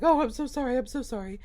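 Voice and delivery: mock flustered apology. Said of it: "And he's like".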